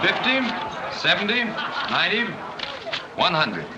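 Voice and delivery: high-pitched count, each number lifted. Acted. Bidder shows the colour of his money, counting out.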